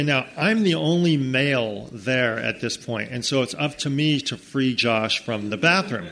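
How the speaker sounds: usual speaking voice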